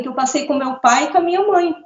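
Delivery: ordinary talk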